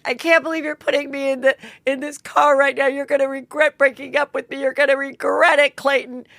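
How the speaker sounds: hammed-up threat from the car; mock jilted Rachel.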